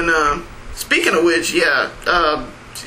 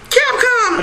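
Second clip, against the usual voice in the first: high, hammed-up cry; mock exclamation. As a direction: squeal the name in mock alarm.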